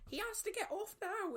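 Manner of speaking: high-pitched chaperone outburst; mock a chaperone yelling way up high; imitated shouting. Chaperone shouting at the director, mock high yell. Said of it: "the chaperones will be shouting at the director".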